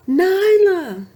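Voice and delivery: high, loud call across the cliffs. A narrator's reading. Mother searching for her lost cub, calling loud and high. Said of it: "called her mother".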